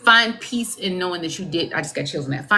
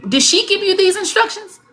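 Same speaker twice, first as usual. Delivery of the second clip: usual speaking voice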